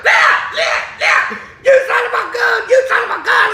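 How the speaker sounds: high, frantic mock screams; panicked screaming. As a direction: yell like a friend gone hysterical. Acting out panicked friend screaming.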